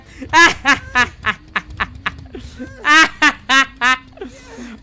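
long staccato cackle of glee; drawn-out ha-ha-ha cackle; gloating laugh. Real, not acted. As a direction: laugh on and on in triumph. Gloating after the taunt.

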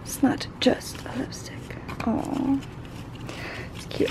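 soft unvoiced whisper over the product. Real, not acted. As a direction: breathe it out, no voice at all. Whispering over the unboxed item.

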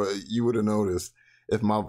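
usual speaking voice

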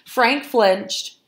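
plain reading voice

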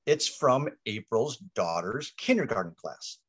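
usual speaking voice

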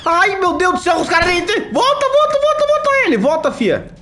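frantic high-pitched yelling; being chased. Real, not acted. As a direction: shriek in real panic, urging her back. Spotted by enemies, fleeing. (gritando apavorado, fugindo)

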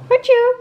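put-on an even sillier sneeze for the baby; squeaky mock sneeze. Nagedaan niesje voor baby. (piepend speels niesje)

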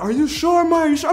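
lightly voiced doubters, high pitch; high-pitched imitation of sceptical buddies; doubting friends. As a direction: question him in a high voice. Retold friends doubting his story.

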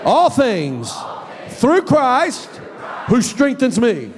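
shouted congregational confession; call-and-response declaration. Shown as a demonstration. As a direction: lead the church in declaring it. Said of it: "say that with me".

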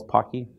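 Pocky as "POCK-ee", short open first vowel; correct pronunciation. Shown as a demonstration. Presenter contrasting two ways to say it, Pocky as "POCK-ee". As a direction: make Pocky rhyme with "rocky". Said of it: "you'll see people pronouncing it".

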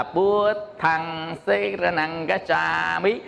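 slow, careful recitation of "Bua thăng xê ra năng gá cha mí"; slow Pali recitation. Shown as a demonstration. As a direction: spell out each Pali syllable slowly. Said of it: "Ví dụ như á". (đọc chậm rãi từng âm tiếng Pali)

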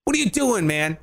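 quick high incredulous voice; lightly voiced disbelief; mock critics. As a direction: fire the question like exasperated fans. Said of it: "They're like".

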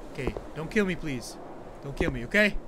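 timid plea. Real, not acted. Softly pleading with the game.

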